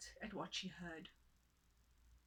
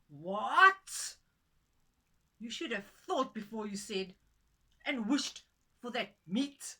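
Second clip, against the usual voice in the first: much louder furious husband; angry shout; 13 dB louder, slower than usual. Acted. Husband scolding his wife for the wasted wish, furious shout. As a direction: yell at her in outrage. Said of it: "he shouted at her".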